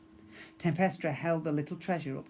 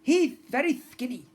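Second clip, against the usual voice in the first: Molar sister; 9 semitones higher, 8 dB louder than usual. Acted.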